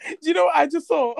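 high, fast, giggly question; excited giggling tease; laughing. Real, not acted. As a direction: tease her while laughing. Laughing, teasing sister.

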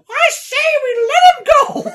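very high falsetto lady voice; shark in a wig. Retold or imitated. Shark in a dress at the town meeting, high falsetto. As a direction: squeak it like a prim old lady. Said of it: "the shark just goes".

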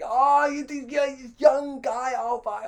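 high, loud, drawn-out irate splutter; hammed-up outraged colonial, lost for words; mock screaming. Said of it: "he was just scre-, he was going".

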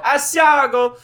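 over-the-top Italian exclamation; mock Italian accent. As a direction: belt it out like a cartoon Italian. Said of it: "Every time I do an Italian voice".

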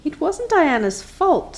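high, slow, sorry confession; contrite. A narrator's reading. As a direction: take the blame humbly. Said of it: "said Anne contritely".

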